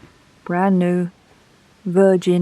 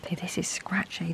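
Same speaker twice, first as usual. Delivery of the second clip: whisper; 11 dB quieter than usual. Real, not acted. Quietly testing a scratchy pen.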